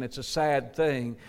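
ordinary talk, nothing marked

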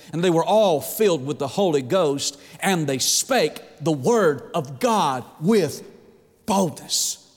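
normal talking voice

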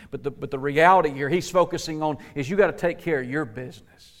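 ordinary delivery

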